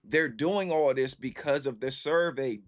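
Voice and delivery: usual speaking voice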